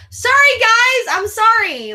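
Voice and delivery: high, loud gushing sorry; excited apology. Real, not acted. Streamer gushing sorry to the chat, high and loud. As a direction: burst out apologizing, giddy. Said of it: "apologizing to chat, loud".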